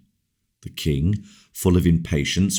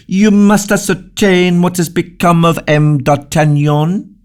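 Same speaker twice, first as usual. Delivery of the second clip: high, loud, cutting royal command; king demanding news of D'Artagnan, sharp and loud; 13 semitones higher, 7 dB louder than usual. A narrator's reading. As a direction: bark the order like an impatient king. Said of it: "said the king very sharply".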